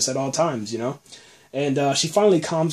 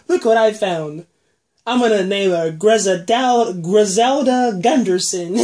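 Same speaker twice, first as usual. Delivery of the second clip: put-on high, slow, sing-song child voice; mock little girl; 5 semitones higher, 5 dB louder, slower than usual. A fan acting out Lizzie naming her doll, hammed-up and high. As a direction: play the creepy girl showing off her doll.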